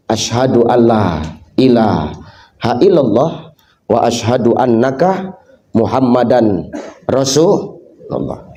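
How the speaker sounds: low, slow reverent chant of the shahada; solemn recitation. Acted. Blind man at the end, slow reverent shahada. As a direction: recite it slowly, deep and devout.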